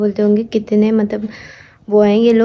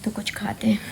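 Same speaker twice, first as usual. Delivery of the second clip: soft whispered aside; 11 dB quieter than usual. Real, not acted. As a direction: breathe it out like a secret plan. Repeats hungry aside to viewers. (फुसफुसाकर कहना)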